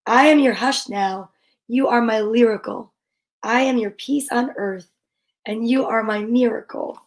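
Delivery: gentle read-aloud of a rhyming picture book; storybook reading. Acted. Parent reading a bedtime rhyme to a child, gentle and unhurried.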